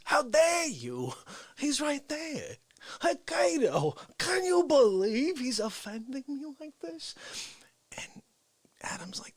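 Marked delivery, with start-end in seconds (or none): 0.0-7.1 high, slow, affronted parody voice; Dick Fauci. Retold or imitated. Fauci complaining to imaginary Cato, offended Fauci impression. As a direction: draw it out in wounded indignation. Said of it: "he looks at him and he goes".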